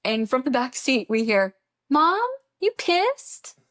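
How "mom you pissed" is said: put-on high, slow little-kid question; hammed-up toddler voice. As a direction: imitate the toddler asking sweetly. Toddler quoted from back seat.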